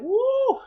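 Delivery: high, loud "woo"; joyful high-pitched whoop; excited whoop. Real, not acted. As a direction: let out a thrilled whoop. Whoops after telling the thrill.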